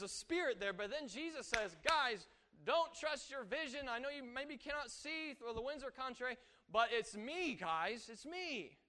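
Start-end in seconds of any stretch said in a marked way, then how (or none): 1.9-8.8 high, raised voice calling to frightened friends; earnest calling out, pitched high; urgent reassurance. Acted. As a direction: plead with them to trust you. Jesus calling to frightened disciples.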